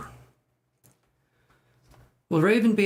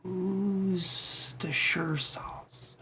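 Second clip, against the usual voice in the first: whispered attempt; 4 semitones higher, 6 dB quieter, much slower than usual. Shown as a demonstration. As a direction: whisper "Who's the sure sauce?" very slowly. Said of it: "that doesn't sound right".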